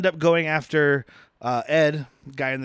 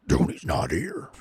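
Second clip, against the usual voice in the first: very low, slow character voice; put-on henchman voice; 13 semitones lower, 3 dB quieter, slower than usual. Mob guy at the door turning her away, deep and slow. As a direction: drop far down and drag the words. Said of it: "that guy is like".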